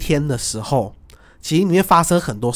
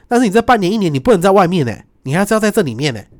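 emphatic quoted boss; 6 semitones higher, 5 dB louder than usual. Retold or imitated. Pakistani boss explaining, emphatic.

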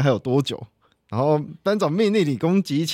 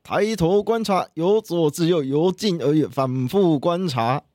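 recited drill; 5 semitones higher than usual. Shown as a demonstration. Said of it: "我们可以来一小段就好。".

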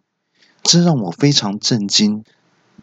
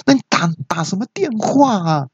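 high-pitched mock yelling; imitated exasperated cry; 10 semitones higher than usual. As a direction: complain in disbelief like the nurse. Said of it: "那小姐听了就大叫说". (学护士又气又急地尖声大叫)

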